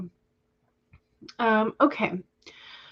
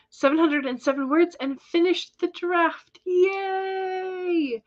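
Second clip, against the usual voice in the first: high bright cheer rising to the yay; excited cheer; 9 semitones higher, slower than usual. Real, not acted. Streamer cheering a viewer's finished draft, high excited yay. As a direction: celebrate her news, thrilled.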